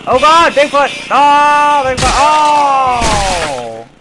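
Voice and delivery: loud, high startled yelling; spaventato. Real, not acted. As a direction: yell out in alarm at the monster. Sudden scare in game.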